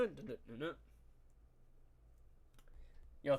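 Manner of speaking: usual speaking voice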